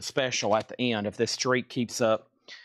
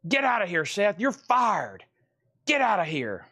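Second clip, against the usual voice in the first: higher mock-angry dog voice; voicing the dog; 5 semitones higher than usual. Owner dubs his dog's chase video: higher mock scolding. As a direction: play the dog yelling at Seth to get out, higher. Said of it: "Let's look at that one more time".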